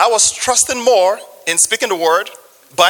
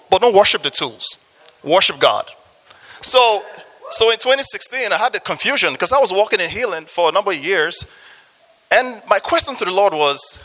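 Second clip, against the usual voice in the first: usual speaking voice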